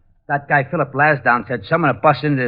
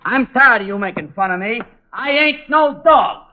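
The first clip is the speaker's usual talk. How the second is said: higher, slow aggrieved protest; hurt whine; 11 semitones higher, 4 dB louder than usual. Acted. Sergeant protesting the detective's dog jokes, hurt whine. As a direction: complain like a wounded pup. Sergeant upset at being mocked.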